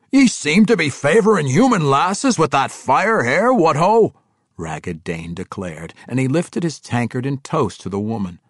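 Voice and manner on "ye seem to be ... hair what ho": loud, high dwarf voice; hearty dwarf banter. A narrator's reading. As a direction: call out a boisterous toast. Ragged Dane toasts the woman.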